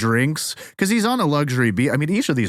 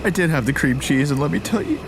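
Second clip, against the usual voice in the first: very high, mock sobbing voice; hammed-up blubbering through the thanks; ugly crying. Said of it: "I'm such an ugly crier".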